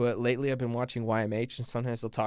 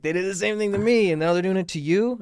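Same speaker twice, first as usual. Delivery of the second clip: indignant; 7 semitones higher, 7 dB louder than usual. Retold or imitated. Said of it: "And I was like".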